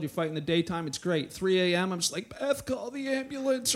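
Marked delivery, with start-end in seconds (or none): 2.3-3.7 higher, mock-desperate plea; man-cold melodrama. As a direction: play the helpless sick husband lightly. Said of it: "you have a man cold".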